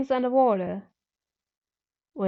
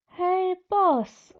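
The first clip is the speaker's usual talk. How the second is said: high, hesitant greeting; unsure how to start; uncertain; 8 semitones higher than usual. A narrator's reading. Narrator greets the boss, high and hesitant. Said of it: "I said uncertainly".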